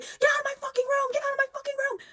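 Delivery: high shrieking scream at someone; retold scream. Naked stranger shrieking at Hayden to leave, high frantic scream. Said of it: "starts screaming at the top of her fucking lungs".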